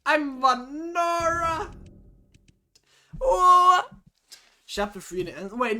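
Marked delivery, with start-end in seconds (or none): 0.0-1.7 high, loud, drawn-out exclamation; shocked outcry. Acted. Shocked reaction to name. 3.2-3.9 very high, loud "what"; startled shout. Acted. Shocked reaction to name.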